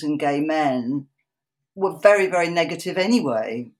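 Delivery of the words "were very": ordinary delivery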